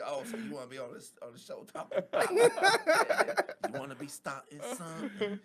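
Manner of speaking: high, quick [name]; giggly [name] impression. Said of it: "Get that [name], [name]".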